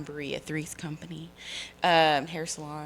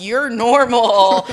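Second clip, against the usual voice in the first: mock relief; 6 semitones higher, 12 dB louder, much slower than usual. Relief at finding normal relative.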